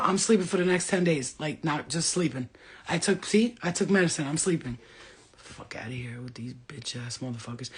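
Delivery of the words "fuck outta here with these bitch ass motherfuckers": whispered, much softer curse; under her breath. Real, not acted. Fed up with texting men, whispered curse. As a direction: mutter it away under your breath.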